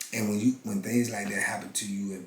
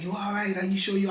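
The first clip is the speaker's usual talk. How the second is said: higher imitation of the worried guard; a hint of an anxious officer, pitched up; concerned guard; 11 semitones higher than usual. Guard at the cell door asks again if he's okay, higher.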